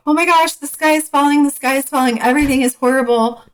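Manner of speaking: high, fast mock panic; panicky. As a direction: squeal it like the sky is really falling. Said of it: "Instead of going".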